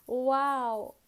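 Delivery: high, loud delighted exclaiming; retold joyful disbelief; thrilled. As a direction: burst out in happy amazement. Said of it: "I went".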